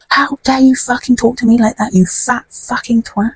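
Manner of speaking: high mock indignation, not shouted; imitating outraged man. Man's outraged retort, retold.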